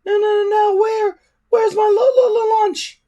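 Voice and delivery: very high, loud whining dragon stammer; whiny dragon. A narrator's reading. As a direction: wail for lunch like a spoilt pet. Toothless demands lunch.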